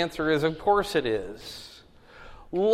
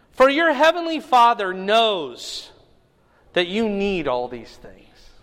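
higher, louder proclaiming voice; scripture reading; 7 semitones higher, 5 dB louder than usual. Acted. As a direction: declare the command to the room. Reads scripture aloud to class.

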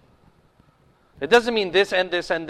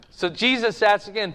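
usual speaking voice

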